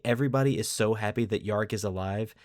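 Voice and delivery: usual speaking voice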